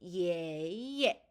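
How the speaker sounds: 예 drawn out rising, then a short dropped 예; long climbing 예, quick low 예; second tone plus neutral tone. Shown as a demonstration. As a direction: glide up on the first 예, let the second fall briefly. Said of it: "이렇게 소리가 나게 됩니다".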